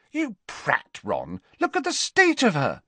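high-pitched angry scolding; indignant telling-off, pitch leaping up. A narrator's reading. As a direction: tell Ron off in a high, cross voice. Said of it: "Ginny said angrily".

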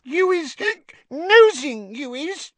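very high, hiccuping squeak; cross, squeaky house-elf; accusing tipsy elf. A narrator's reading. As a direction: accuse him in a drunken little squeak. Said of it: "frowning up at Harry".

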